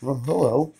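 ordinary talk

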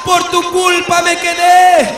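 loud, driving sermon cry; preaching at full force. Acted. Preacher warns the church it may be left behind, full-force shouting. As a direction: hammer the warning home to the congregation.

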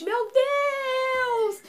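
high, stretched-out squeal; screaming fans, imitated. Siblings amid the screaming crowd, imitated high squeal. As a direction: squeal it long and high without full volume. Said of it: "tava todo mundo numa gritaria".

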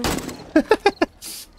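squeaky unvoiced giggle; high laugh. Real, not acted. Laughs at game creature.

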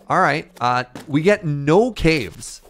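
normal talking voice

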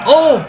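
sudden high yelp of fright; startled yelp. Real, not acted. Watching a creepy VR video, a startled high yelp. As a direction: jolt out a short high "Oo!".

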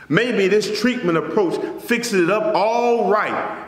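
usual speaking voice

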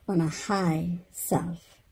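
plain reading voice

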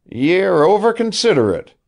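mock his scruples; sneering old man. A narrator's reading. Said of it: "he said".

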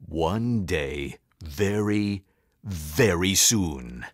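deep, drawn-out villain threat; low and slow, pausing between the words; mean old principal plotting revenge; menacing vow. A narrator's reading. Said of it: "Mister Krupp vowed".

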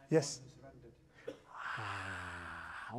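ordinary talk, nothing marked

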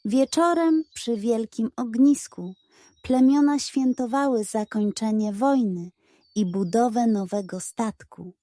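plain reading voice

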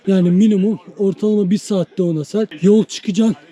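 ordinary delivery

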